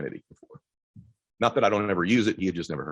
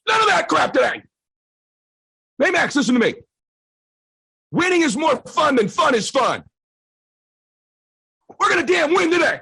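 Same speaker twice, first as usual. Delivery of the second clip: much higher, louder barked orders; angry yell; 6 dB louder than usual. Retold or imitated. Dad yelling at his son to stop talking about fun, loud. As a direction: yell him into wanting to win.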